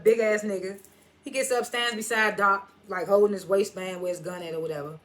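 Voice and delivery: usual speaking voice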